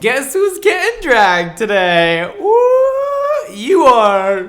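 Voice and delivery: playful announcement rising to a loud whoop; gleeful teasing. Real, not acted. Reviewer teases the creator who hoped for dragging, gleeful. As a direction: crow it, with a high whoop midway.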